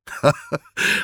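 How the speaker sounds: merry breathy chuckle; a stranger laughing off an insult; cheerful laugh. A narrator's reading. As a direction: laugh it off, amused. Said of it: "roześmiał się wesoło".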